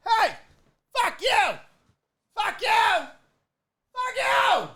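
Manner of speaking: high, strained mock shout, each burst set apart; imitated yelling. Streamer imitating Mattress Mac heckling back while walking away, mock yelling. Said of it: "he was just yelling".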